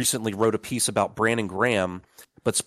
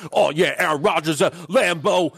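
loud, high-pitched mock pundit rant; hammed-up sports pundit, pitched up; Stephen A. Smith; 6 semitones higher, 5 dB louder than usual. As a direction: imitate a talking head spouting nonsense. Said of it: "he's just speaking nonsense. He's like".

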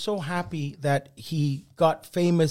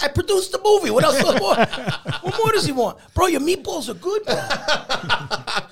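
mock-exasperated, laughing through it; exasperated producer; 9 semitones higher than usual. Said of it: "He's like".

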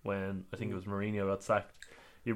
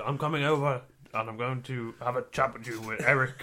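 Tyson Fury. Retold or imitated. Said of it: "we do far too many accents in this".

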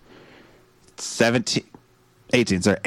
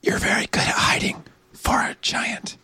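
hushed whisper from a hiding place; 3 dB quieter than usual. Acted. Player whispers to the giantess while both hide, whispering. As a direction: whisper it under cover.

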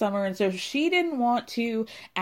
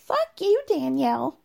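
Fuck you pitched way up, hammed-up; mock shrieked insult; 6 semitones higher than usual. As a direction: squeal the second Fuck you in mock outrage. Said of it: "Paige looks over and says".

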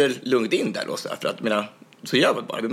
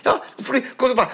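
high, rushed raised voice; retold shouting; 14 semitones higher, 3 dB louder, faster than usual. Retelling the heated exchange with the guard, shouted quickly. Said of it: "Så skrek jag bara".

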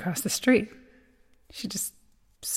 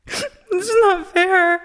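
sobbing, much higher and slow; crying; 10 semitones higher, 4 dB louder, much slower than usual. Acted. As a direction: let the sob break through. Grieving sister breaks down.

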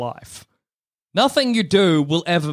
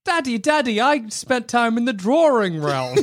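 put-on excited kid telling daddy; child voice; 7 semitones higher than usual. Voicing child in story.

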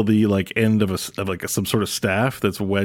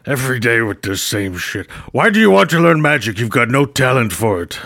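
scornful wizard; 2 semitones higher, 4 dB louder than usual. Acted. Archie scornful.

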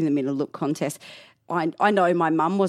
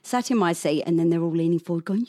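ordinary talk, nothing marked

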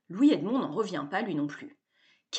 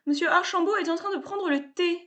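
higher, stiff butler voice; haughty butler retort; 10 semitones higher than usual. A narrator's reading. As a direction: answer back like a prim butler. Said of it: "rétorque le majordome".

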